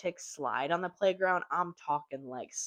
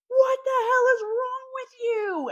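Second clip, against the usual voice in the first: much higher, louder, drawn-out outrage; horrified outrage; 9 dB louder than usual. Retold or imitated. Horrified parents yelling at the cruel dad, high and loud. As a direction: shriek it in disbelief.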